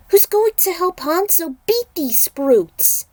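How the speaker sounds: high, breathless whisper; whispered question. Acted. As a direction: whisper it urgently. Aiko asks, whispered.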